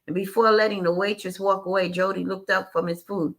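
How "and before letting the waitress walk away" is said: ordinary delivery, nothing marked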